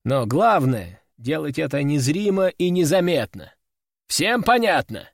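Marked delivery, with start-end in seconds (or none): none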